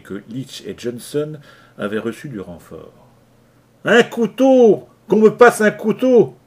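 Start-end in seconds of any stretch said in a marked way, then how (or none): 3.8-6.4 high, loud desperate cry for a knife; shouting. Acted. Leach in the dark fight, shouting for a knife. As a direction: yell it out over the brawl. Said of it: "criait Leach".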